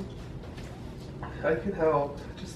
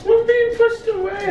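melodramatic lament; 3 dB louder than usual. Pretend. Melodramatic repeat, high.

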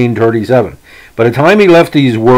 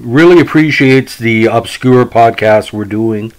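usual speaking voice

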